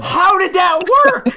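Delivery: high, slow, loud disbelief; incredulous high-pitched yell; astonished outburst. Real, not acted. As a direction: shout it, utterly baffled. Stunned that the search worked.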